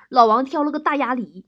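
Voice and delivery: ordinary talk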